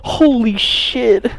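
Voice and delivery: ordinary talk, nothing marked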